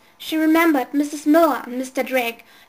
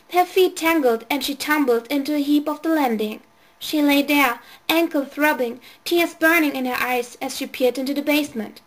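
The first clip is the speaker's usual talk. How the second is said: ordinary delivery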